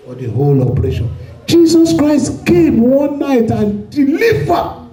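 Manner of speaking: normal talking voice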